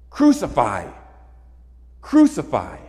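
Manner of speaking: loud, chanted crowd cry; crowd yelling. Retold or imitated. Preacher voicing the mob yelling at Pilate, loud shout. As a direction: shout it like a mob demanding death. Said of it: "join the religious authorities in yelling".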